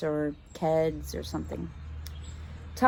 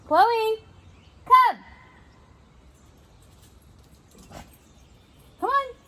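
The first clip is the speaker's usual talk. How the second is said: high, loud call; calling the dog; 6 dB louder than usual. Real, not acted. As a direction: call the dog over from across the room. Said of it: "calling her dog".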